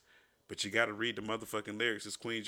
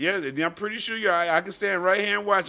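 loud, raised-pitch accusation; heated accusing; 9 semitones higher, 12 dB louder than usual. Acted. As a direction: argue loudly across the checkout. Acted checkout quarrel, accusing.

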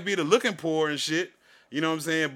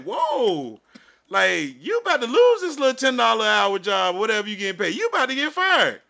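heated warning; 6 semitones higher, 5 dB louder than usual. Retold or imitated. Warning the cashier.

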